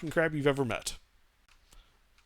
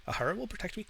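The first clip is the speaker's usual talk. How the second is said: higher, softer frightened chitter; quiet chittering voice; 7 semitones higher, 6 dB quieter, much faster than usual. Acted. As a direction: whisper it to yourself like a hiding crab. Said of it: "like, chittering voice behind it".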